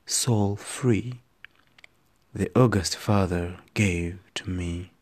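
slow, dramatic poetry performance; poem recitation. Acted. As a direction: read it like a stage poem.

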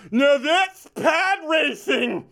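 slow, high, loud mock yell; imitating a dad yelling at the screen. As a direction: bellow it across the theater like dad. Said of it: "My dad would yell".

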